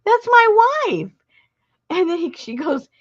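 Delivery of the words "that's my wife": slow, high, loud proud declaration; proud husband. Retold or imitated. Husband answering the little girl, proudly slow. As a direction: say it slowly, proudly, pitched up. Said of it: "And Richard goes".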